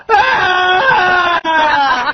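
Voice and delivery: imitated long scream of a crying kid; child's wail. Imitating four-year-old bawling on phone.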